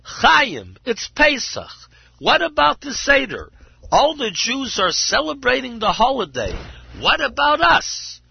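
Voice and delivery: high, loud pleading cry; desperate cry. Acted. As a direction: cry out to her husband in despair. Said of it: "she cried".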